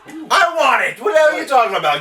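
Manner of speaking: high, loud grabby outburst; excited protest. Real, not acted. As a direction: shout your claim on the prize. Jumps on the offered prize.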